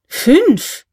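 high, loud astonished cry; astonished exclamation. A narrator's reading. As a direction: blurt it out in disbelief. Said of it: "rief K".

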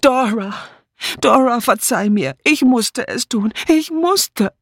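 high, panicked pleading to a ghost; high frantic plea for forgiveness; desperate cry. Acted. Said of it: "Sie schrie".

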